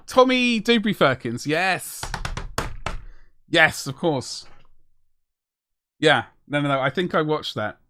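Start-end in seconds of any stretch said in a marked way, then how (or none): none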